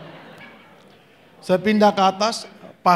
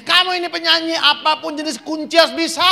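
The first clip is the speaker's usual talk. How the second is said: high, pressing mock lecture; mock scolding; 9 semitones higher than usual. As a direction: lecture the singer in a raised pitch. Retelling musician scolding him. (omelan tiruan bernada tinggi)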